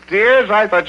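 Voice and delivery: teasing son feigning shock; mock surprise. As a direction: pop up in playful surprise. Son teases crying mother.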